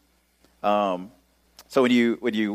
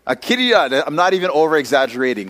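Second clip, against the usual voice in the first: normal talking voice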